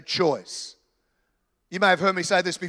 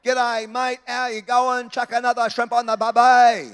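higher-pitched imitation of a bad Australian accent; mock Australian accent; 4 semitones higher, 4 dB louder than usual. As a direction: ham up a fake Australian accent. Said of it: "trying to do an Australian accent".

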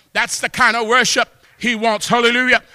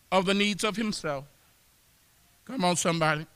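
very soft and low, with long pauses between phrases; hushed preaching; 4 semitones lower, 10 dB quieter than usual. Real, not acted. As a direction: preach it quietly, letting each phrase settle. Hushed reflective close after climax.

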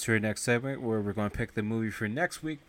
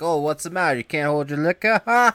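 taunting; 6 semitones higher, 9 dB louder than usual. Retold or imitated. Kid voiced, taunting Duran.

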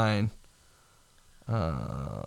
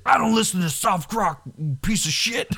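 higher, hammed-up thug sneer; Candyman thug retort; 7 semitones higher than usual. Villain snaps at Nick Cage, hammed-up sneer. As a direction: spit the insult like a movie villain. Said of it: "He's like".

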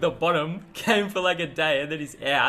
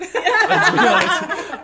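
higher, slow, laughing hard; giggling through the words; 8 dB louder, much slower than usual. Real, not acted. Laughing about teasing Jal.

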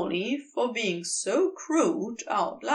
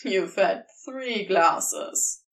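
dry Crowley; 4 semitones lower, 3 dB louder, slower than usual. A narrator's reading. Crowley teasing the angel.

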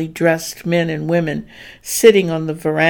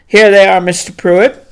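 loud eager greeting; 2 semitones higher, 8 dB louder than usual. Acted. Driver greets the master.

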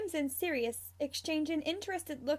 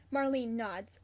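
ordinary delivery, nothing marked

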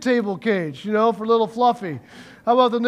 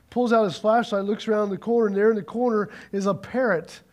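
ordinary talk

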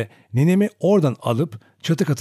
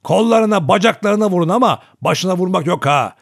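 loud, high-pitched command; grating order; 7 semitones higher, 6 dB louder than usual. A narrator's reading. Grandfather ordering his companions in the fight, grating shout. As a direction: call it out sharply over a fight. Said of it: "aynı tırmalayıcı sesle".

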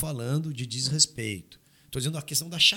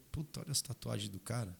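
low, quiet sneer at his tattoos; mocking tone; 5 semitones lower, 16 dB quieter than usual. Shown as a demonstration. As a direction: say it with a provocative sneer. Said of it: "se eu faço a mesma pergunta dizendo". (em tom de deboche, baixo e provocador)

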